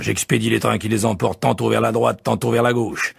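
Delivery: matter-of-fact switchman voice; dry, businesslike railway worker; the switchman explaining his job to the prince. Acted. Switchman character.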